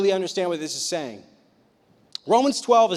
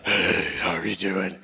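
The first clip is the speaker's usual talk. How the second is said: very low, slow, unenthusiastic greeting; regular you; 12 semitones lower, 3 dB quieter than usual. Acted. Teen greeting someone in person, low and sluggish. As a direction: drop the pitch right down and drag the hello. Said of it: "Regular you is like".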